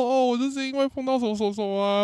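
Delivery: high, put-on crying voice; hammed-up sob story; mock tearful pleading. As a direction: wail out excuses through fake sobs. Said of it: "我就大哭".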